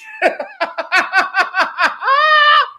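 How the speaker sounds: high, shouted laugh of joy; elated laughter. Real, not acted. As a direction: burst out laughing in triumph. Laughs after kick.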